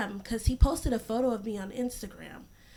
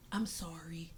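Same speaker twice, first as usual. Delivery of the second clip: flat sorry; 5 semitones lower, 11 dB quieter, slower than usual. Retold or imitated. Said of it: "Like, literally".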